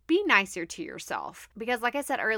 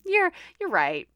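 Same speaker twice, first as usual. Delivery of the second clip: high-pitched, giggling agreement; retold giving-in, cracking up; laughing concession; 10 semitones higher, 4 dB louder than usual. Laughing concession to her kids' teasing.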